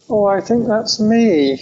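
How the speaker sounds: put-on high, slow little old lady voice. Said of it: "there'd be a little old lady go".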